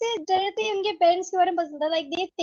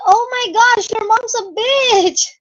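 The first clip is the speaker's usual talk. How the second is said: high, loud, drawn-out indignation; theatrical indignant outburst; mock outrage; 5 semitones higher, 7 dB louder, slower than usual. Acts out her outraged reaction.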